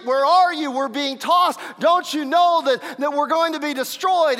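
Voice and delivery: high, loud pleading shout; desperate cry. Acted. Disciples calling to sleeping Jesus in the storm, desperate cry.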